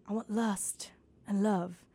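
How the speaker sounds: soft, slow R and B croon. Retold or imitated. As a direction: croon it like a song intro. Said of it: "the thing he would whisper".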